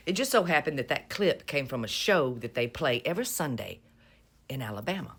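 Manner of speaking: normal talking voice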